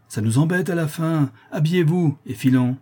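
exasperated, brusque command; impatient order. A narrator's reading. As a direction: hurry them out crossly. Bailiff hurries the prisoner.

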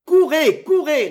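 high, loud shouted command; enraged. A narrator's reading. Baron sends soldiers after the fugitive, shouting enraged. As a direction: bellow each repeat in a rage. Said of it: "répétait le baron au paroxysme de la rage".

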